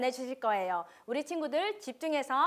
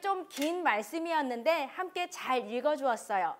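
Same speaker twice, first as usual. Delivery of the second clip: usual speaking voice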